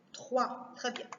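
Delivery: ordinary talk, nothing marked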